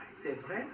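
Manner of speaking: C'est vrai? asked, tune rising on vrai; rising question. Shown as a demonstration. Teacher repeating the question form. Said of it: "this makes it a question".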